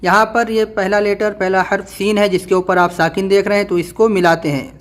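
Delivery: ordinary talk, nothing marked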